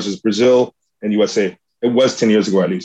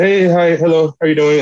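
cheery greeting; 6 semitones higher, 3 dB louder than usual. Retold or imitated. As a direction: imitate a carefree hello to a baby. Acts out fighters greeting their babies.

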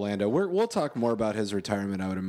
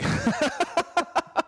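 burst of laughter. Real, not acted. Cracking up at his own story, real laughter. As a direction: crack up hard at the punchline.

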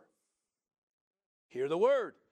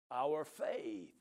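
ordinary talk, nothing marked

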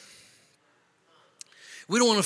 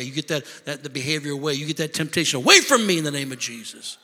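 ordinary talk, nothing marked

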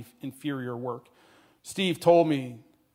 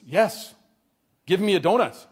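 eager acceptance; 4 semitones higher, 5 dB louder than usual. A narrator's reading. Newcomer eagerly accepts the donut.

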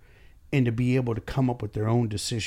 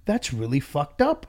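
higher, louder apologetic sympathy rising to a squeak; mock sympathetic; 9 semitones higher, 4 dB louder than usual. Boss plays apologetic rep to upset customer, mock sympathy. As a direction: overdo the sorry customer service voice. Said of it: "And be like".

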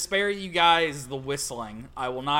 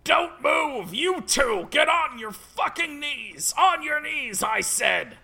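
high, loud shouted commands; hammed-up police yell; yelling cop; 10 semitones higher, 4 dB louder, slower than usual. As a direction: bark the orders at the prisoners. Cop yelling in read-along.

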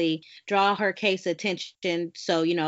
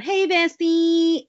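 excited greeting; 7 semitones higher, 6 dB louder, much slower than usual. Real, not acted. Greeting best friend on podcast.

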